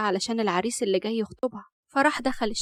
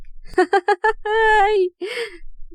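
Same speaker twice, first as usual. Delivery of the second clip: high, loud scornful laugh; bursting into laughter; 9 semitones higher, 5 dB louder than usual. A narrator's reading. Shocked Rahaf laughs at Murad's claim, breaking up. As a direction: collapse into a peal of laughter. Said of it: "وانهارت شوية في الضحك".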